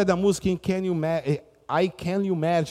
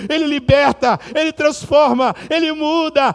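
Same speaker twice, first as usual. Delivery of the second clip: climbing higher and louder preacher cry; climactic preaching; 11 semitones higher, 6 dB louder than usual. Acted. Preacher proclaiming that Jesus changes lives, climbing to a loud climax. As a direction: drive each phrase up to a peak.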